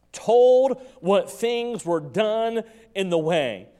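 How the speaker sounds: rising, loud preaching to the congregation; preacher pouring it out to the church, loud and rising; full-force preaching. Acted. Pastor stirring the congregation, loud full-force preaching.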